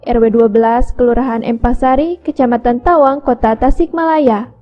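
loud, punchy hard-sell pitch; infomercial. Acted. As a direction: sell it hard like a TV shopping host. Hard-sell call to order.

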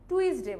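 টুইসডে said as too-is-day; common mispronunciation. Shown as a demonstration. Teacher showing learners what many say, mispronounced too-is-day. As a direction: split the vowel in টুইসডে, "tu-is". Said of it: "অনেকে কি বলে?".

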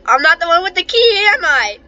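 ordinary talk

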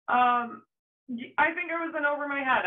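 normal talking voice

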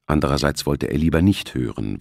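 plain reading voice

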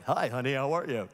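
low, smooth radio DJ voice; deep DJ-style phone greeting. Retold or imitated. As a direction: greet like a disc jockey on air, pitched low. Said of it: "Stop talking in that voice".

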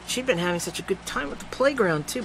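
usual speaking voice

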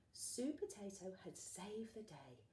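usual speaking voice

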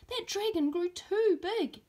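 put-on wide-eyed child reporting the huge dragon; excited little boy.

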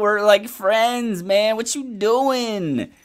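high, drawn-out plea to a bro; whiny-high "come on, man" protest; exasperated plea. Acted. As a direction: plead with a buddy, stretching the last words. Paraphrasing the singer, pleading with friend.

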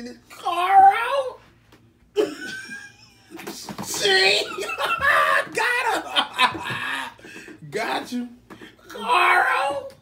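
shrieking high through laughter; cracking up, squealing; laughing hysterically. Real, not acted.

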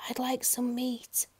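slow, high whisper; tiny hushed request; timid whisper. A narrator's reading. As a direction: whisper the order meekly. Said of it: "he whispered".

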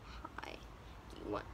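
hushed whisper, almost no voice; whispered offer. Retold or imitated. Jack timidly offering the cow, whispered. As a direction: sneak the question out in a whisper. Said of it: "and he's like".

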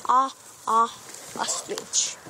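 "ah, ah" as short O, opening "ostrich"; two separate "ah" sounds, then "ostrich"; short vowel demo. Shown as a demonstration. Child teacher showing viewers the letter sounds, short vowel demo. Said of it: "O makes the short sound".

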